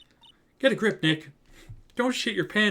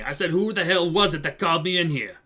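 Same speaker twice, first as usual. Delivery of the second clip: bad Brooklyn accent; 5 semitones higher, 4 dB louder than usual. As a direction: bellow it like a Brooklyn mobster. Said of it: "introduced a bad Brooklyn accent".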